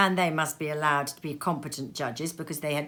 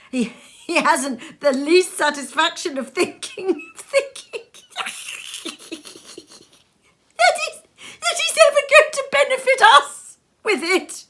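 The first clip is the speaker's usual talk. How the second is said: higher, louder, breaking into laughter; laughing through words; 9 dB louder than usual. Acted. Nephew to his wife, laughing helplessly. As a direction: laugh so you can barely finish.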